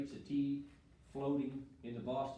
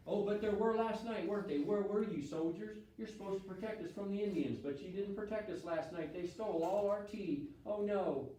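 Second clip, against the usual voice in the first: louder, higher, hammed-up fake concern; sarcastic mock dismay; 8 semitones higher, 4 dB louder than usual. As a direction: taunt the soldiers with mock sympathy. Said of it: "You see the sarcasm?".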